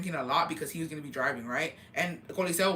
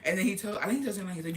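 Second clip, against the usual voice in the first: ordinary talk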